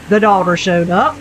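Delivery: ordinary delivery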